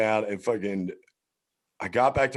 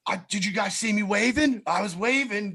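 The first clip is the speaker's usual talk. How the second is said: high-pitched mock indignation; indignant protest; 12 semitones higher than usual. Prisoner protesting to cops he had waved, mock indignant. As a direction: act out protesting your innocence.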